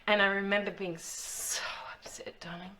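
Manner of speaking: soft whispered memory, breaking into a laugh; hammed-up tragic whisper; mock sad whisper.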